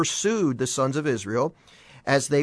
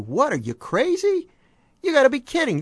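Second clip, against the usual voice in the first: much higher, mock disbelief; incredulous scoff; 11 semitones higher than usual. Preacher voicing a doubter scoffing at your faith, mock incredulity. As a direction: scoff at the idea like it's absurd.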